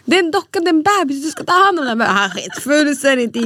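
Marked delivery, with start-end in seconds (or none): 0.0-2.0 put-on higher, instructing a toddler; mock mother lecturing her son; scolding mom voice. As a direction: lecture the boy about the doll. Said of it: "Så jag bara".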